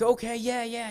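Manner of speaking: high-pitched eager agreement; eager, acted-out child's reply; super excited. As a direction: imitate an excited kid saying yes. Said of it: "you know, super excited".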